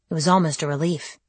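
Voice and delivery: usual speaking voice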